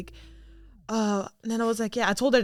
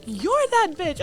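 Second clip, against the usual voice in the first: idol's playful echo; 11 semitones higher, 6 dB louder, slower than usual. Retold or imitated. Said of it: "And she was like".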